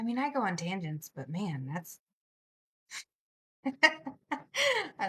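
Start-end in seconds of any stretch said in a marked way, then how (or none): 3.6-5.1 slow words squeezed out through a laugh. Real, not acted. Streamer laughing at her own tangents, laughing.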